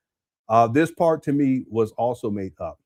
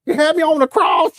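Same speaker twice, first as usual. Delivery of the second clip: high, loud mock woman's voice; mocking her; 14 semitones higher, 9 dB louder than usual. Retelling the woman's claim to his viewers, in a high mock voice. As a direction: squeal her line up high in imitation. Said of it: "I can't believe she said".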